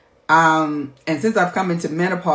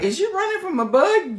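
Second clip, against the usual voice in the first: teasing sons; 12 semitones higher than usual. Retold or imitated. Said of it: "my sons be like".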